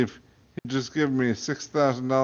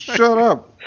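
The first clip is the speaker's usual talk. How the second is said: mock snap; 10 semitones higher, 9 dB louder than usual. Real, not acted. Reader snaps at laughing friend.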